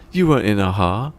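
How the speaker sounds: disbelieving. Retold or imitated. Greg Davies doubting the driver's band story, disbelieving. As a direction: call his bluff.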